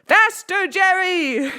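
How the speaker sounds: high, loud scream. A narrator's reading. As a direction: scream at the top of your lungs. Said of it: "screaming at the top of his lungs".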